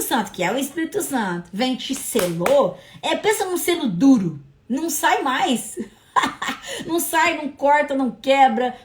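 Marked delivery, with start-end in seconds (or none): 6.1-6.8 short high chuckle; amused laugh. Real, not acted. Preacher tickled by the unbreakable seal, short high laugh. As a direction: break into a brief chuckle.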